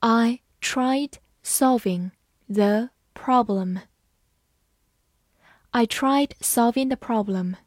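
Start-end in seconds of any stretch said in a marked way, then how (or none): 0.0-3.8 slow, deliberate read. Shown as a demonstration. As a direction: read it slowly word by word. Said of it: "我们把第二个句子慢读一遍".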